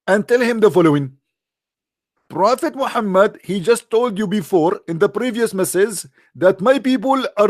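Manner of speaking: mock exasperated rant at the angel. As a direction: scold the messenger, fed up. Muhammad ranting at angel.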